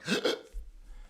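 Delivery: sharp, audible breath in; shallow gasp. Shown as a demonstration. Showing how nerves make us breathe, with a shallow gasp. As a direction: demonstrate an anxious, shallow gulp of air. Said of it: "we're always like".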